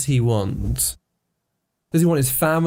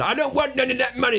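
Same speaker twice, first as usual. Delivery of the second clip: put-on Southern accent; 12 semitones higher, 3 dB quieter than usual. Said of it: "his emotional reaction is in his proper accent".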